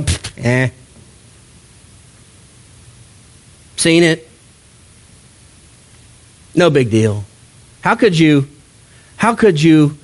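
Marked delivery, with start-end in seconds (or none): 0.0-7.2 dismissive shrug. Retold or imitated. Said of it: "hear Paul's stories and go".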